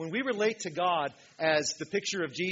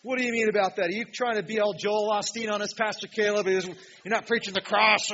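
louder, higher voice of a heckling critic; mock indignant objector; 5 semitones higher, 7 dB louder than usual. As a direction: challenge the pastor in mock outrage. Voices an objector challenging the pastor.

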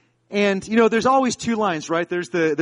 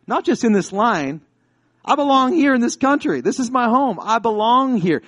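raised, insistent declaration; standing his ground; 7 semitones higher, 5 dB louder than usual. Retold or imitated. Student to the agent in this country, raised insistent claim. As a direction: imitate someone firmly claiming their place, pitch up. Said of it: "how he stood his ground".

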